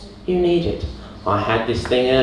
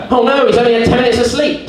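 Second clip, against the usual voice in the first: higher, louder, fast panicked cry; the body frets he only slept ten minutes, higher louder panic; 8 semitones higher, 6 dB louder, faster than usual. Acted.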